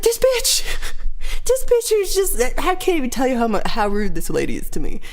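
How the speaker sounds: high, indignant rant with a laugh; lightly hammed-up fury; mock outrage. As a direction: vent in exaggerated disbelief. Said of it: "And I was like".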